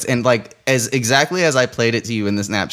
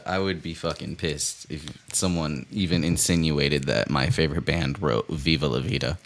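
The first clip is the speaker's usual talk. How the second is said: quiet deadpan; 8 semitones lower, 9 dB quieter than usual. Real, not acted. Mock outrage, deadpan.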